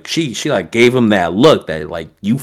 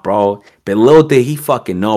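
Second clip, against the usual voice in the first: ordinary delivery, nothing marked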